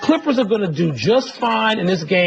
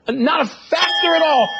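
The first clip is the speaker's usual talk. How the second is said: emphatic shout; 8 semitones higher than usual. Real, not acted. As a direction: bark out the punchline of the argument. Emphatic debate point.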